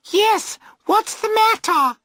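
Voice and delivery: very high, loud call from the bank; shouting back. A narrator's reading. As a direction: girls shouting back from the towpath. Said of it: "they shout back".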